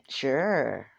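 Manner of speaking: drawn-out, loaded "sure"; knowing sure. Retold or imitated. Philza agreeing to a visit, drawn-out knowing sure. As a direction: stretch the word out with a swooping tune. Retold reply, later questioned tone.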